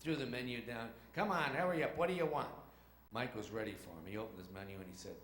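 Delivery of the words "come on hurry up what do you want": higher, impatient demand; rude waiter attitude. Acted. Waiter to two kids with menus, impatient. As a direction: hurry the customers along rudely. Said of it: "They had that kind of attitude".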